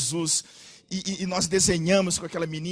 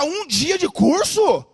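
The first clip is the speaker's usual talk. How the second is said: indignant disbelief; 8 semitones higher, 10 dB louder, much slower than usual. Retold or imitated.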